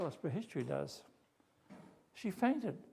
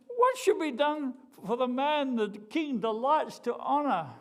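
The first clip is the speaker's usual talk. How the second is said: lightly voiced king, high and loud; regal king; 8 semitones higher, 6 dB louder than usual. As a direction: ask it with royal grandeur. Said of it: "the king asked him".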